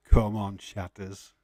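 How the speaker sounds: usual speaking voice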